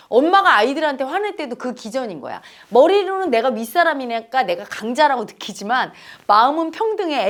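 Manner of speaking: ordinary talk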